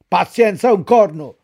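loud, corno hit hard; furious yell. A narrator's reading. The master bursting into the stable, yelling. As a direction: yell it at the donkey. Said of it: "urlò il padrone".

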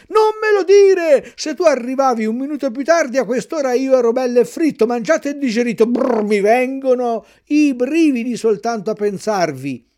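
loud, high, fast frightened rush; frightened outburst. A narrator's reading. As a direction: still trembling at the thought of being fried. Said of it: "urlò Pinocchio che tremava ancora dalla paura". (sfogo spaventato e concitato)

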